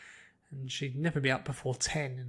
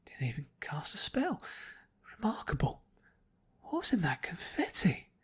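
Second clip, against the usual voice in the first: hushed unvoiced whisper among a group; secretive breathy murmurs; 4 semitones higher, 3 dB quieter than usual. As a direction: whisper it so she barely overhears. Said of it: "She could hear them whispering".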